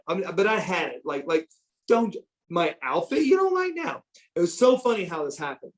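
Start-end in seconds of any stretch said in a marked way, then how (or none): 1.9-4.0 high-pitched disbelieving protest; indignant disbelief, pitched way up; incredulous. Retold or imitated. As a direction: replay the complaint in an incredulous, rising pitch. Incredulous complaint about outfit criticism.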